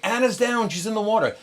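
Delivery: high, loud imitated radio alert; urgent alarm. Crew member reports casualty by walkie-talkie, alarmed. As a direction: mimic a frantic battle report.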